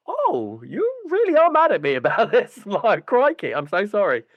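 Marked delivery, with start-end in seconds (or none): 0.1-2.6 high, amazed oh, laughing; genuine surprise. Retold or imitated. Telling the neighbour he's really angry, in high astonishment. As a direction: sound astonished he's still mad. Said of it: "I was genuinely like".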